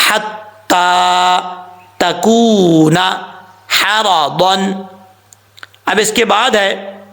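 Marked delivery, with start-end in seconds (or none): 0.1-4.8 Quran recitation. Shown as a demonstration. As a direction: draw out each word like a recitation. Reciting correctly.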